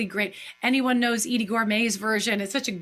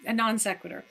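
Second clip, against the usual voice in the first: usual speaking voice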